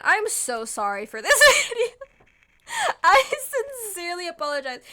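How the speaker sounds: sorry but giggling at herself; laughing apology. Real, not acted. Laughing apology for rambling video.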